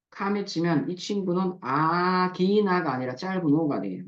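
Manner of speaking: normal talking voice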